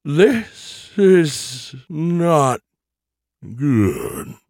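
drawn out and slurred, very slow; like a tape slowing down. Acted. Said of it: "Her voice slowed down, drawn out and slurred".

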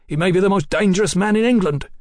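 high-pitched, alarmed denunciation; agitated warning. Acted. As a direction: warn urgently of grave danger. Mallory warns, agitated.

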